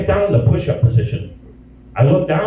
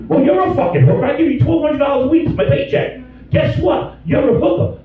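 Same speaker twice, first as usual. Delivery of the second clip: mock rant; 8 semitones higher than usual. Ranting at wife.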